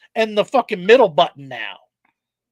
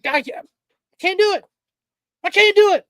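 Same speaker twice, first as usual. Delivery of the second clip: mock despair; 9 semitones higher, faster than usual. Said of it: "I was like".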